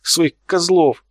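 high, indignant outburst, stretched at the end; upset exclamation. A narrator's reading. Offended artist tells the mockers to leave, exclaiming angrily. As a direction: send them packing, wounded and cross. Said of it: "воскликнула та".

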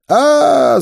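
loud high scream of horror; old man's yell. A narrator's reading. Old man bitten in the neck, loud high scream. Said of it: "заорал старик".

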